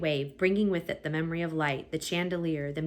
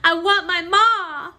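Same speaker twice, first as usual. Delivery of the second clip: high, slow wail for her mother; high, slow childlike cry for ma; cried out; 13 semitones higher, 11 dB louder, slower than usual. A narrator's reading. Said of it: "cried Midge".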